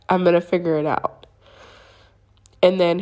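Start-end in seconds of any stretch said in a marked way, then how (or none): none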